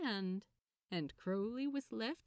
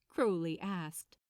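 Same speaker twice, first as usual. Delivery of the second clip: plain reading voice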